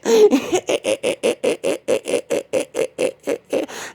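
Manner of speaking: long high maniac cackle; crazy laugh. Retold or imitated. Woman chasing David up the block, crazy high cackle. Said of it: "started doing that crazy laugh".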